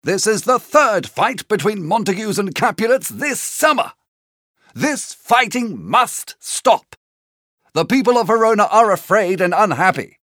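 stern, emphatic royal rebuke; angry prince. A narrator's reading. Angry prince scolding both families, stern declamation. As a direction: punch the words, commanding without shouting. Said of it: "The prince was very angry".

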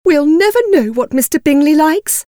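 high, cross, complaining wife. A narrator's reading. Wife annoyed that husband won't visit, cross. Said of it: "said Missus Bennet crossly".

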